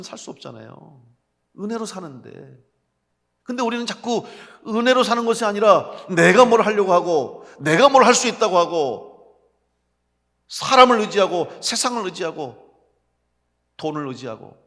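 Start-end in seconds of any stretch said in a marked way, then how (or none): 3.4-14.6 loud, raised preacher's voice; forceful preaching. Real, not acted. Preacher confronting believers about self-reliance, forceful preaching. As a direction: drive each clause home loudly.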